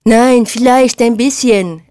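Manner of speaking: slow, high, drawn-out aping; jeering, stretched-out imitation; mocking mimicry. A narrator's reading. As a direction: ape them with slow exaggeration. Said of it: "äffte Pauli nach".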